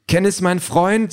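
high, slow indignant wife; protesting Denise. A narrator's reading. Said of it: "sagt Denise".

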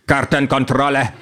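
lightly voiced rasping, over-clipped doorman; a hint of a ridiculous overstressed voice; rasping overstressed android. A narrator's reading. Android doorman checks tickets, mildly rasping and overstressed. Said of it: "mit seltsam schnarrender, lächerlich überbetonter Stimme".

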